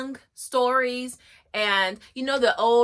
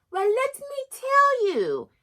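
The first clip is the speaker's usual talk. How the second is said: much higher, slow little bear voice; baby bear voice; 13 semitones higher, slower than usual. Acted. The baby bear starting to tell his side, high and slow. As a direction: squeak it out slowly like a tiny cub. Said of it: "says the baby bear".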